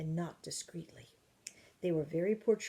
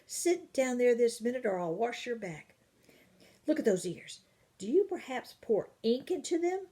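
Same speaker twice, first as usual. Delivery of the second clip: fierce nanny; 5 semitones higher, 5 dB louder than usual. Acted. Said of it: "Old Golly looked exceedingly fierce".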